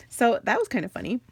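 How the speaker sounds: usual speaking voice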